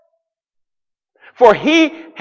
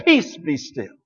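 hushed command; 4 semitones lower, 7 dB quieter than usual. Retold or imitated. Jesus stills the storm.

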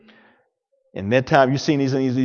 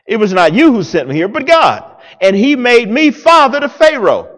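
loud, high preaching of Joseph's words; preaching at full force; 7 semitones higher, 7 dB louder than usual. Acted. Preacher voicing Joseph to his brothers, loud and high. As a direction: proclaim it to the congregation.

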